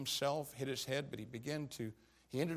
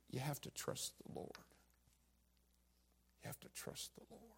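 much softer, near-whispered reassurance; hushed counsel; 9 dB quieter than usual. Retold or imitated. Pastor gently telling a grieving church member, hushed. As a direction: murmur it as comfort at a bedside. Pastor quoting his comfort words.

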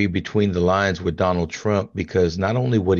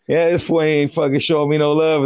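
indignant; 7 semitones higher than usual. Retold or imitated. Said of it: "And I'm like".

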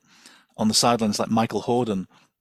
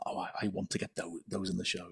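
muttered under the breath, much softer; a hushed imitation of the producer's wish; half-swallowed mock aside, very soft; 4 semitones lower, 15 dB quieter than usual. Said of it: "Innes Lloyd has gone".

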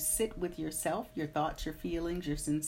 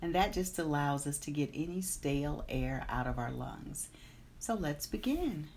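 guided meditation; 4 dB quieter than usual. Acted. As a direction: guide them calmly into the day. Leads a meditation.

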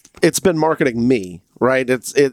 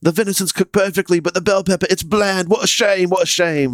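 Gordon Ramsay impression in a Jamaican accent, high; a high-pitched Jamaican-accented chef verdict; 7 semitones higher than usual. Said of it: "Why are you doing a Jamaican accent?".

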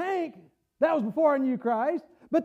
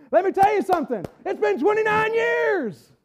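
fervent preaching; 7 semitones higher, 4 dB louder than usual. Real, not acted. Preaches at full force.

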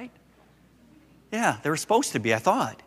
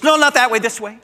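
put-on much higher and louder, a little girl insisting; bossy toddler correction; 11 semitones higher, 8 dB louder than usual. Granddaughter ordering grandpa which way to turn, high and loud. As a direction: snap the correction like a bossy three-year-old. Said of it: "She goes".

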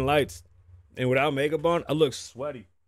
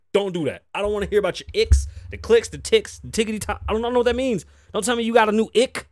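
ranting; 9 semitones higher, 5 dB louder, faster than usual. Real, not acted. Ranting against icks in comments.

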